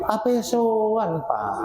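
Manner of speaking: slow teasing apa soan; slow question. Shown as a demonstration. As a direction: stretch apa soan out slowly as if prodding.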